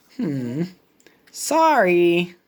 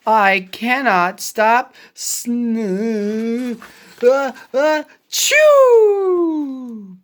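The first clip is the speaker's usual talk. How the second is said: slow sneezing words; 3 dB louder, slower than usual. Acted. Gerald sneezes mid-sentence.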